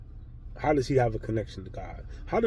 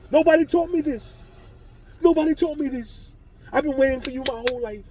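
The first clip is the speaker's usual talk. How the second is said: much higher, pleading, breathless; frantic apology; 11 semitones higher, 3 dB louder than usual. Retold or imitated. Apologizing to God, a high frantic plea. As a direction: beg forgiveness in a rush.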